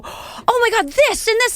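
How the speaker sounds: high, mock-starstruck realization; hammed-up "it just hit me" voice; acted sudden realization. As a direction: play it as a high-pitched dawning shock. Acting out a sudden delayed realization.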